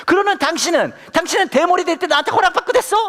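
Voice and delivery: high, indignant wife's comeback, acted; 버럭 화내는 아내. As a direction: explode in mock fury as the wife hitting back. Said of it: "화를 버럭 내면서 이렇게 얘기하는 거예요". (버럭 화내는 아내 흉내)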